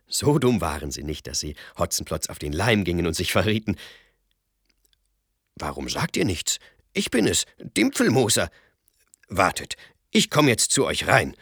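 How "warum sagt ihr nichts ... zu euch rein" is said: verstellte Räuberstimme. Acted. Hotzenplotz gibt sich als Dimpfelmoser aus.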